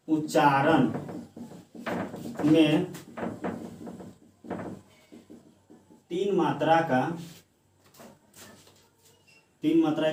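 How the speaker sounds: word by word with long pauses, for writing down; slow dictation. Shown as a demonstration. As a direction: dictate slowly so students can copy. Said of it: "देखिए, तो लिखेंगे". (धीमे-धीमे लिखवाते हुए)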